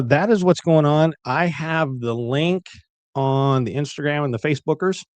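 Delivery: normal talking voice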